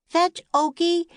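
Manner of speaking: high bright shout to a puppy. A narrator's reading. Annie shouting for the puppy to fetch. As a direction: call it out across the yard. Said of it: "Annie shouted".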